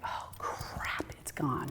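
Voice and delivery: breathy whispered dismay, almost no voice. Retold or imitated. Alone at night, finding her necklace gone, whispering. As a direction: hush it out as the loss sinks in.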